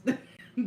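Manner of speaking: a sudden giggle; burst of laughter. Real, not acted. Laughing at granny's correction.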